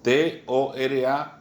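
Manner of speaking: T O R A spelled slowly, letter by letter; slow dictation of T O R A, one letter at a time. Shown as a demonstration. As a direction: spell out T O R A with space between the letters. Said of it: "acento en la A".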